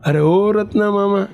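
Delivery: ordinary delivery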